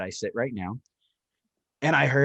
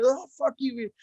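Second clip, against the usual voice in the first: high put-on woman's voice; woman at the window; 12 semitones higher than usual. Quoting the movie's woman, high mocking retort. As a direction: snap back like the woman in the film. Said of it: "And then the woman".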